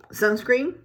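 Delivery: ordinary talk, nothing marked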